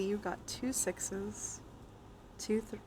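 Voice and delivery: ordinary delivery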